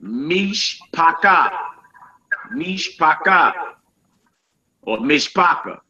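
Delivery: Mishpaka drawn out slowly, meesh-pah-KAH; slow Hebrew drill. Shown as a demonstration. Teacher modeling Mishpaka, slow and clear. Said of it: "We got also family".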